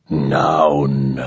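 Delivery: low, slow machine-like voice; rusty metallic alien. Acted. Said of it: "disturbingly rusty and metallic".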